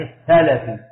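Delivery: السلفي said "as-salafi", a after seen and after lam; two fathas. Shown as a demonstration. Teacher glosses the vowelling, السلفي said "as-salafi" with two fathas. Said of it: "فتحة السين وفتحة اللام".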